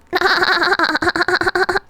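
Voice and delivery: high squeaky laugh like a dolphin. Real, not acted. Streamer laughs at chat like a dolphin. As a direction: let a shrill dolphin laugh fly. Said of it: "Do I sound like a dolphin?".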